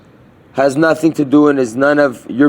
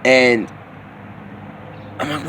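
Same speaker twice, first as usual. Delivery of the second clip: ordinary delivery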